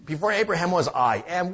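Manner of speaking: high, loud sneering repeat of the quote; mock quoting Jesus. Heckler repeating Jesus' words, high and loud. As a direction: parrot it back like an offended pedant. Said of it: "He did".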